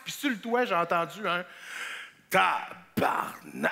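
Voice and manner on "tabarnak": low, very slow swearing; deep drawn-out curse; slow-motion curse. Acted. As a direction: drag out each syllable in stunned dismay. Swear word heard on the roof.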